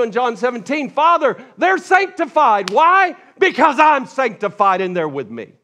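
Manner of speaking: high, loud, soaring preacher's cry; full-force preaching. Acted. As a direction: preach it out with fire.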